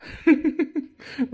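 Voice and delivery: high loud laugh; real belly laugh; loud burst of laughter. Real, not acted. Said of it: "हसू हसू तर पोट दुखीन आमचं".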